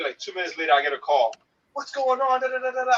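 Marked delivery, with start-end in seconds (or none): 1.7-2.9 high mock voice of the girlfriend; squeaky teasing girlfriend impression. Man imitates his girlfriend on the phone, high and mocking.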